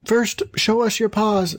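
put-on high, slow young-goat voice; wary kids. A narrator's reading. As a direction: demand proof as a wary little kid. Kids question the wolf.

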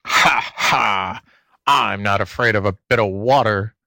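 lightly voiced sneering fox; snickering fox. A narrator's reading. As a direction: open with a mock snicker. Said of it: "snickered the fox".

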